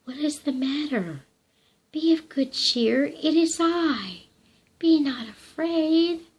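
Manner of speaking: shepherd cheering the frightened woman; cheery and strong. A narrator's reading. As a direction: lift her up with strong cheer. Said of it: "He sounded so cheery and full of strength".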